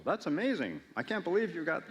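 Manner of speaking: high, gushing amazement; mock amazement. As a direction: gush as if wowed by the news. Job was just to gush.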